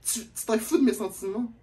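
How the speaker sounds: put-on high whiny girlfriend voice; mocking the girl. Storyteller mocking the girl's whining complaint.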